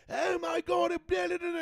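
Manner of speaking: high-pitched mock panic shout; hysterical commentator. Commentators overselling turn one, hysterical mock shouting. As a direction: shriek it as if disaster struck, dissolving into babble. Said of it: "It sounds like fucking there's, like, the most, like, like, twelve people just got killed".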